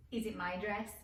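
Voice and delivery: ordinary talk, nothing marked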